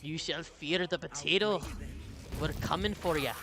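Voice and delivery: put-on playful Irish accent, bit Scottish. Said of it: "that was more Scottish than Irish".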